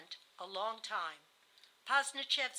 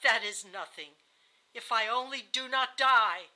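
loud, scornful dismissal; feverish outburst; 8 dB louder than usual. A narrator's reading. Dying wife rejecting her husband's apology, feverish. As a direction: throw the words out forcefully. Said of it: "her eyes shone feverishly".

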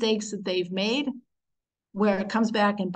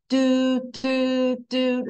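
three short, separate hummed toots; short toots held on one level note; broken shofar sound; 3 semitones higher, much slower than usual. Shown as a demonstration. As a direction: imitate broken horn blasts, evenly spaced. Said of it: "It's like a broken sound".

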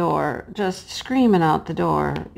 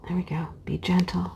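soft whisper while placing something delicate; hushed, careful whisper to herself; 8 dB quieter than usual. Real, not acted. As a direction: breathe the words out gently.

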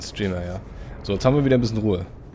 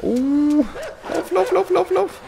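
high panicked run run; 4 dB louder, slower than usual. Real, not acted. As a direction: shriek at yourself to run. Fleeing monster in game.

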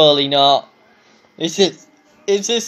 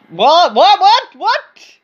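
repeated "what" climbing higher and higher; rising disbelief; 9 semitones higher, 5 dB louder, much slower than usual. Real, not acted. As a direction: start startled, end in a high squeal of disbelief. Sudden shock at the game.

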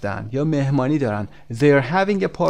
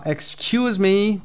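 slow, raised-pitch call of complaint; mild mock shout; 7 semitones higher, much slower than usual. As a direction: call out as the annoyed man, only half shouting. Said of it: "داد میکشه این آقا". (فریاد ملایم و نمایشی)

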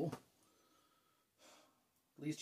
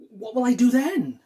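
worried question, raised and louder; anxious; 6 semitones higher, 7 dB louder than usual. Acted. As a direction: ask it in rising panic. Fears parents' reaction to news.

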